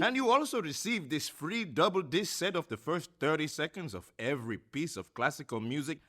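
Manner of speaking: fast-talking sideshow huckster selling records; a hammed-up barker's spiel, swelling to a big finish; carnival barker pitch. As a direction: hawk it like a fairground barker.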